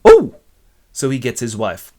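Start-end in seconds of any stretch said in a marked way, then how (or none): 0.1-0.4 loud, very high yelp; short squeal of excited surprise; delighted exclamation. Acted. Man offered money for information, high loud yelp.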